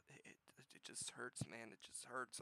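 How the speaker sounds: very soft and slow after a sigh; pained dejection. Real, not acted. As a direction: let it out weakly, like it hurts. Dejected over franchise purchase.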